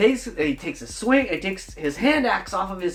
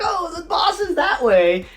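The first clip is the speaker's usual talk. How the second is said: eager goblin; 10 semitones higher than usual. Acted. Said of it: "the goblin's like".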